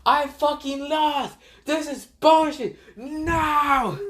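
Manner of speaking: high, drawn-out wailing yell; screaming in protest. Retold or imitated. Dragon raging over his lost bet, mock screaming. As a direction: wail every word way up high. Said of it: "screams so loud that even the Raikage can hear him".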